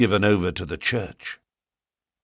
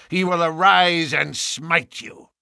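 cried threat; 8 semitones higher than usual. A narrator's reading. Young Drebber threatening Ferrier with the Lord. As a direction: call down judgment on him. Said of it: "cried young Drebber".

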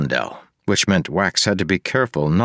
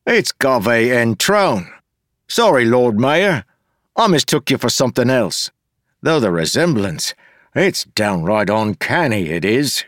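high, loud, mocking rogue voice; a rough joker jeering at the mayor; cheeky Wayne; 6 semitones higher, 3 dB louder than usual. Wayne insulting the mayor to Wax, high mocking jeer.

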